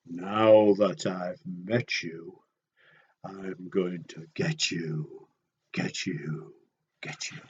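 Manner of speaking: low, slow, soft threat; menacing Get You Bird. Acted. Reader making the predator bird sound scary to children, low and slow. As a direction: creep in slowly, low and menacing. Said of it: "this is scary".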